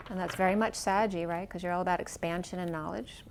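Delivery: ordinary talk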